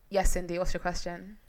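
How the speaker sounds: lightly played host, low and soft; understated pretend moderator; mock question-taking host. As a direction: quietly call on an imaginary audience member. Pretends to take an audience question.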